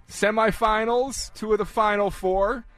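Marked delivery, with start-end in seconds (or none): none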